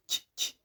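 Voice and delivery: "ch" as quiet, voiceless pops; two separate soft "ch" bursts; ch sound. Shown as a demonstration. Teacher repeating the sound C H gives, short soft "ch". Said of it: "That's the sound it gives us".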